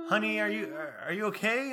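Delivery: concerned lady. Retold or imitated. Middle-aged lady checking on a stranger, high concerned imitation.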